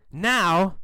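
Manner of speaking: plain reading voice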